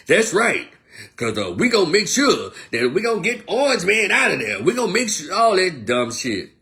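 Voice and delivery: high, loud mock rallying voice; hammed-up, excitable campaign promise; mock politician rallying. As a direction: imitate a politician pandering with swooping emphasis. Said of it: "But when they get in your face".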